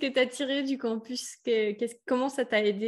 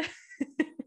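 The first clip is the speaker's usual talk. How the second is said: light high laugh that falls away; a short amused laugh; real laugh in the background; 5 semitones higher, 5 dB quieter than usual. Real, not acted. Amused reaction to interviewee.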